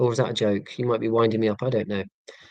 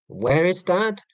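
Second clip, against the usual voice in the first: lightly voiced child's question, pitched up; higher, questioning kid impression, mild; imitating his kids; 6 semitones higher, slower than usual. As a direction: lightly mimic a kid asking, rising at the end. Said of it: "If the question goes from where to who".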